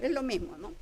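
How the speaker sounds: ordinary talk, nothing marked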